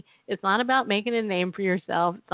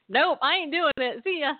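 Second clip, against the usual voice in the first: higher, flippant brush-off; mock-cheerful refusal, pitched up; breezy refusal; 7 semitones higher than usual. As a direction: toss it off like you're bailing. Acts out her refusal of God.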